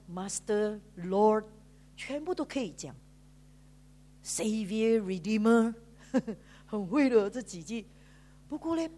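usual speaking voice

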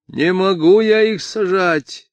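put-on high, loud drunken protest; drunken police chief. A narrator's reading. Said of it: "сказал пьяный голос полицмейстера".

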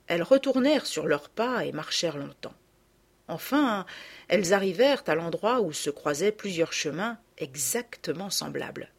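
usual speaking voice

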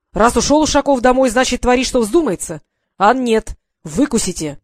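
loud furious dressing-down of the clerk; the general berating the clerk; angry scolding. Acted.

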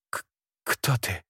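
strained, faint stammer; forced out in pain. Acted. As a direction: squeeze the words out through pain. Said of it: "выдавила из себя Кшара".

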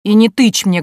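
loud, punched-out reprimand; indignant barked command; angry bark. Acted. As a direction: snap each order like an offended uncle. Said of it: "рявкнул он".

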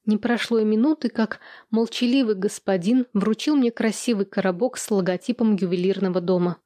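ordinary delivery, nothing marked

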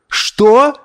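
high, outraged question; indignant exclamation. A narrator's reading. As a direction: cry out in disbelief and outrage. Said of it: "возмущённо воскликнули все". (возмущённый высокий возглас)

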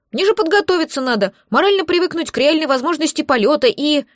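high panicky squeak. Acted. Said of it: "запищала Липучка".

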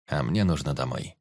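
low warrior voice; warrior Тирот, earnest. Acted.

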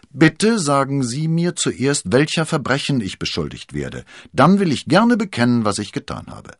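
high, loud, measured plea for the charge; bold request. Acted. D'Artagnan asks the cardinal to name his crime, bold. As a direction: state it boldly, easing off at the end. Accused man answers the cardinal boldly.

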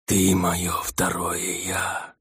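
slow, breathy rustling whisper. A narrator's reading. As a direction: rustle it out like dry leaves. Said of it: "прошелестел голос у стены".

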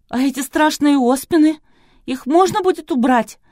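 high, loud, imploring question; pleading. A narrator's reading. Kristina begs to have the scary pockmarks removed, pleading. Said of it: "взмолилась Кристина".

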